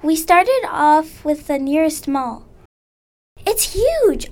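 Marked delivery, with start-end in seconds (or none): 3.5-4.3 higher, bright cry of amazement; excited exclamation. Acted. Carl, seeing the huge mall, exclaims in amazement. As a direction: marvel at the size out loud. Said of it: "I exclaimed".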